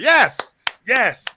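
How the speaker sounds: high, loud cheer imitating his wife. Husband retells his wife cheering a score, high excited yell. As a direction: shout it like a thrilled fan. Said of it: "I hear my wife going".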